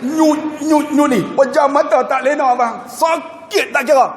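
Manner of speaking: high pained sing-song; mock pain moan. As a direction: whimper the throbbing pain. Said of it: "Benda nyut bang".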